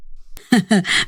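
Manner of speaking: short low chuckle; brief teasing male-role laugh; amused chuckle. Acted. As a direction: chuckle knowingly. Protector amused by her bravado.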